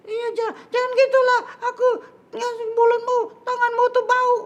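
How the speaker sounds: loud, very high squeaky complaint; indignant high-pitched puppet; protesting little puppet. Acted. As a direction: object in a tiny high-pitched voice.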